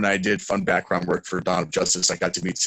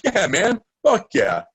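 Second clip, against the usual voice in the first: hyped agreement; 10 semitones higher, 4 dB louder, slower than usual. Retold or imitated. Luke Perry quoted.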